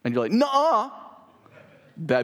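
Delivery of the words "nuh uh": much higher, louder playground nuh-uh; childish denial. Retold or imitated. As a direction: answer like a stubborn little kid. Adult answering like a child.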